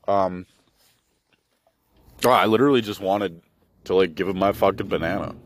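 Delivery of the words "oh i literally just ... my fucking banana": usual speaking voice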